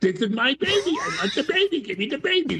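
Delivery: mock-shrill woman grabbing for the baby; demanding woman. Woman demanding the baby from Solomon, high laughing mock shout. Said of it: "she goes".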